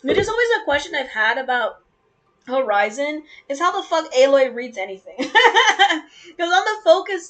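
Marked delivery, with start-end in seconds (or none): 3.5-7.3 laughing while talking. Real, not acted. Laughing mid-rant.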